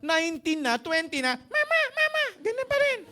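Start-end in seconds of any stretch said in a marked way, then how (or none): 1.3-3.0 grown person stuck with a tiny, very high voice; comically high-pitched, squashed voice; squeaky dwarf voice. Shown as a demonstration. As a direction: squeak it like a little duwende. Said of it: "Para ka pa rin duwende".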